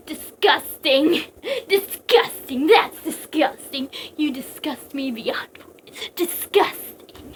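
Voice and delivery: slow, drawn-out, hammed-up disgust; mock disgust. Quoting favorite Fire Emblem meme, theatrical slow disgust. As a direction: stretch the word out with relish.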